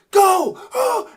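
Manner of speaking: loud, high stadium yells; fan screaming. Retold or imitated. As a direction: yell like a crazed fan. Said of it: "you see people screaming".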